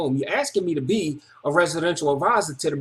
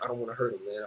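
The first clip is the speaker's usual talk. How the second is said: held-back anger; 6 semitones lower, 11 dB quieter, faster than usual. Retold or imitated. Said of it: "I'm like".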